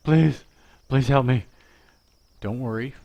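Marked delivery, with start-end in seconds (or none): 0.0-1.4 loud, high begging voice; high, loud whiny plea for help; mock pleading students. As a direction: play the panicked student crying for help. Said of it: "I know you're thinking".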